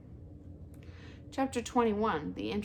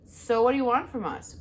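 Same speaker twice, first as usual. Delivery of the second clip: mild growl; 5 semitones higher, 7 dB louder than usual. A narrator's reading. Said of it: "growled Fink".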